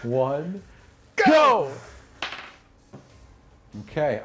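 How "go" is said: loud, high call to launch the jump; shouted go. Real, not acted. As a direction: yell it to start the leap. GM counting down to jump.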